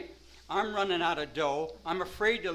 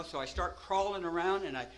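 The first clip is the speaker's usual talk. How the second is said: ordinary delivery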